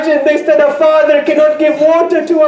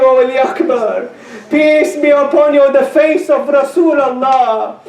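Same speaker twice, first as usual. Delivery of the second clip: ordinary talk, nothing marked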